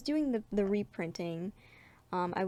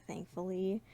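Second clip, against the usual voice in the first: usual speaking voice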